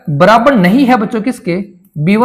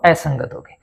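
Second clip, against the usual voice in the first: quiet class answer; 7 semitones lower, 6 dB quieter than usual. Pretend. Teacher voices class answer.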